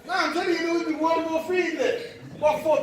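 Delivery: high, loud comic rant; worked-up, half-laughing remonstration; mock heated protest. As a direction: raise pitch and volume in playful outrage. Comic imagined escalation with audience.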